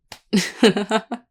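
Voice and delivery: bright teasing chuckle; playful laugh. Acted. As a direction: laugh at your own joke. Laughs at own tease.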